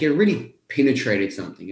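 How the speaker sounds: ordinary talk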